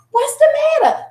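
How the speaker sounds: very high, urgent question; alarmed mom. Retold or imitated. Acts out rushing to crying child.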